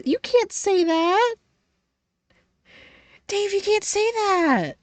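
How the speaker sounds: very high, slow prissy voice; mock scandalised squeak. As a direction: gasp it out high and prim.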